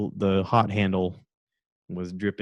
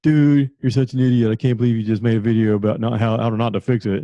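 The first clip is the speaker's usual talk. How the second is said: louder, sneering troll voice; mocking commenter; 3 dB louder than usual. Online commenter mocks the video maker, louder and sneering. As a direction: jeer at him as an idiot. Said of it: "I get all the ones on the question one".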